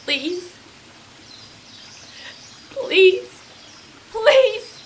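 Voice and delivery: high, loud pleading cry; beg, the voice cracking upward; loud, high, anguished begging; desperate pleading. Acted. Begging to be left alone.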